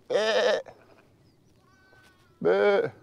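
high bleating call to lambs; sheep bleat. Retold or imitated. Calling to lambs again.

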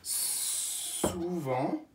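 Souvent stretched so each sound is heard. Shown as a demonstration. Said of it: "Alors j'écoute les sons".

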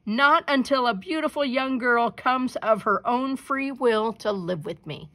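the dragon laying down his terms; dragon roar. A narrator's reading. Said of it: "roared the dragon".

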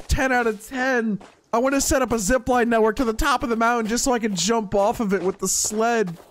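ordinary talk

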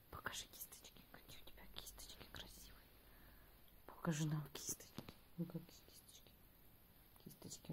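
hushed whisper to the cat. Real, not acted. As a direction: whisper softly, coaxing. (шёпотом, ласково)

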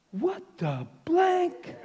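high, slow astonished gasp of disbelief; mock the man's shocked reaction; stunned what the blank. The soldier reacts in shock to his healed knee, high and astonished.

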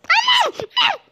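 high shocked wail of no; dismayed high cry. Real, not acted. Young gamer watching a goal go in against him, shocked high cry.

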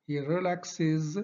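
"relaxes" said very slowly, ending clearly as iz; iz ending demo. Shown as a demonstration. Teacher drilling the iz ending, very slow. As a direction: stretch "he relaxes" so the extra iz syllable is heard. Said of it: "اللي كنطقوها is".

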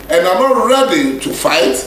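ordinary talk, nothing marked

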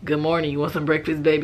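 low, put-on morning voice flirting with a future guy; hammed-up deep sweet talk; mock deep flirt. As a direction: play the smooth low-voiced charmer. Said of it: "revealing my morning voice".

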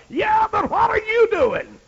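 high, loud sarcastic shout; hammed-up taunt; mocking retort. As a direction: fire it back with mock scorn.

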